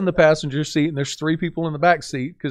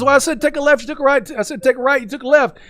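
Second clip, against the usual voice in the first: scolding coach; 9 semitones higher, faster than usual. Retold or imitated. Driving coach scolding a student for wrong turns, exasperated. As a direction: lay out the mistake, exasperated.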